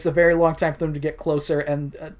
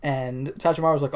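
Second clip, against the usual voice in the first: usual speaking voice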